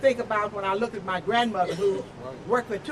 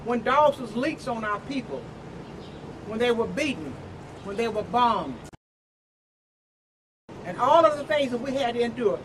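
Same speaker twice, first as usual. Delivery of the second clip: normal talking voice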